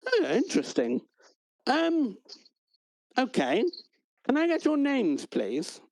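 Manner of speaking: a hint of a cheery desk clerk; mild clerk voice.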